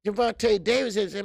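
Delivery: ordinary delivery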